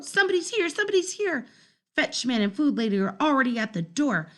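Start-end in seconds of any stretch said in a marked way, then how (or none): 0.0-1.5 high, urgent announcing; excited alert. Acted. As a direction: call out in alarm.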